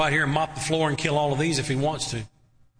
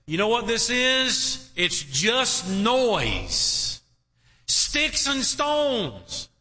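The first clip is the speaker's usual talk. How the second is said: high, slow, drawn-out scoffing as David; David dismissing taunts; 7 semitones higher, much slower than usual. Acted. David brushing off the enemies' barking, slow and high. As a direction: stretch each word out like shrugging off noise.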